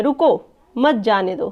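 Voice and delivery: a teacher's demonstrated order to stop, pausing after रुको; pause after रुको. Shown as a demonstration. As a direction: break after रुको, then मत जाने दो as one phrase. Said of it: "किसी को हम आदेश दे रहे हैं".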